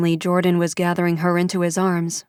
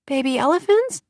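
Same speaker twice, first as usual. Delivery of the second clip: put-on high, unsure child's voice; a small girl answering; higher child's voice, a touch unsure; little girl; 8 semitones higher than usual.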